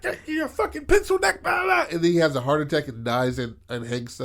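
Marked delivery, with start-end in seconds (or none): 0.0-1.9 very high mock rant at no great volume; imitated yelling. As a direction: rant like an irate cartoon character, pitched way up. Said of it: "just yelling at Dale".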